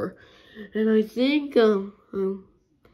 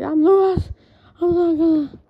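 panicked; 7 semitones higher, 6 dB louder than usual. Acted. Panicking alone, lost.

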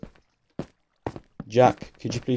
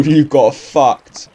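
slow words through real laughter; gloating laugh at the mobs; much slower than usual. Real, not acted. Gamer watching mobs fight, laughing slowly at them. Mobs kill each other.

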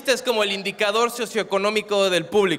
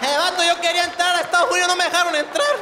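mock-Arabic coastal accent; 6 semitones higher than usual. Man from Guerrero barred from the States, mock-Arabic coastal accent. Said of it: "Se vuelve como árabe".

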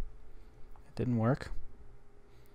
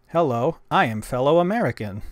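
louder, careful phrase for the translator; clear dictation into phone; 3 semitones higher, 8 dB louder than usual. Shown as a demonstration. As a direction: speak it clearly into the app. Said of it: "let's try this".

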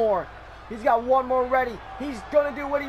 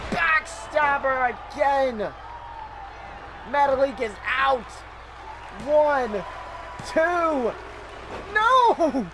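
excited play-by-play; 2 semitones higher, much slower than usual. Real, not acted. Commentator shouting in disbelief at the kickout. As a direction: sell the shock of the kickout. Live call of another kickout.

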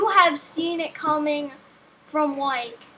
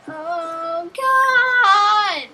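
exasperated cry; 4 semitones higher, 8 dB louder than usual. Real, not acted. Frustrated gamer exclaims loudly.

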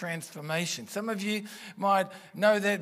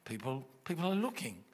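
softened, cautioning inner voice; inner thought voice; 5 dB quieter, faster than usual. Acted. Preacher voicing the thought that stops worshippers, softly cautioning. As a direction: lightly imitate a discouraging thought, trailing softer. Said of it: "it was telling you not to".

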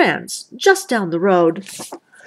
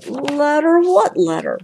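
higher, puzzled double-take; surprised Brother; 7 semitones higher than usual. A narrator's reading. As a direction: ask it in disbelief, voice rising. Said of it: "asked Brother".

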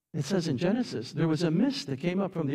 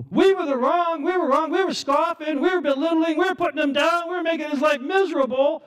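high, loud frantic wailing; panicked confession; 13 semitones higher, 7 dB louder than usual. Acted. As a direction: cry out your guilt as the flood rises. Scoffers panicking as flood rises.

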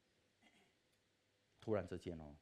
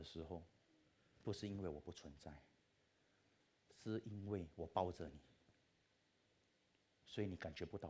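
God's reassuring voice; 6 semitones lower, 9 dB quieter than usual. Retold or imitated. God speaking to the weary man, soft and reassuring. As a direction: whisper comfort like God holding you. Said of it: "上帝跟你说".

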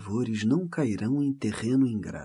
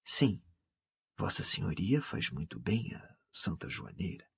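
very soft, low and deferential; lowered voice; 7 semitones lower, 10 dB quieter than usual. A narrator's reading. Coadjutor to the canon after a silence, voice lowered. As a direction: drop right down in volume and pitch. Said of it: "baixando a voz".

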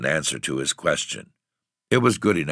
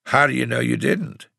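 sharp question; 10 semitones higher, 4 dB louder than usual. A narrator's reading. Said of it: "looked at him sharply".

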